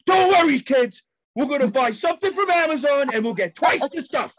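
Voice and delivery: put-on rally the kids at full volume; worked-up shout. Dad storming out, shouting reassurance to his kids. Yelling to kids leaving store.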